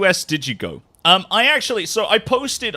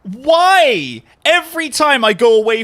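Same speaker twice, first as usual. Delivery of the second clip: exasperated; 10 semitones higher, 7 dB louder, slower than usual. Real, not acted. Exasperated rant about YouTube changes.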